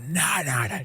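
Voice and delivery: breathless whispered nah, nah; whispered denial. Retold or imitated. Heroine seeing her mate dead, whispering in disbelief. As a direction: deny it under your breath in shock.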